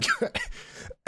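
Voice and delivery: airless choking cough; coughing up water. Real, not acted. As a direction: cough it out, throat blocked. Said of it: "that went down the wrong pipe".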